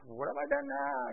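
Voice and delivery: high, soft protesting whine; put-upon younger brother. Acted. Younger brother protesting to his older brother, aggrieved. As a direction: complain like a kid brother wrongly blamed. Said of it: "just like a younger brother to an older brother".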